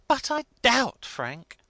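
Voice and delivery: a hint of a high, tactful wife; gentle hint. A narrator's reading. Wife hinting doubt to her husband, lightly high. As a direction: suggest it delicately. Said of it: "Missus Milvey hinted".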